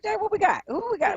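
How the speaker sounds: high-pitched surprised squeal. Real, not acted. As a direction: caught off guard, voice jumps way up. Two callers suddenly on line.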